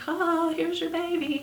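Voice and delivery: lightly mocking the cliché delivery-room line; mock movie cheer. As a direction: parody the sweet movie handover. Mocking the typical movie birth scene.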